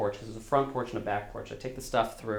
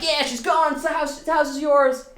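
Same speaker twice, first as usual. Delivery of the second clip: much higher, louder, thrilled voice; excited friend; 6 dB louder than usual. Retold or imitated. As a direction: cheer like a buddy sharing good news. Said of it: "he's like".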